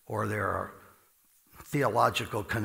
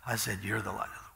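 whispered; 3 semitones lower, 6 dB quieter, faster than usual. Retold or imitated. God repeats his whisper.

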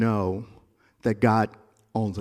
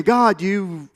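retold plea; 6 semitones higher, 5 dB louder than usual. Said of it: "And I'm saying".

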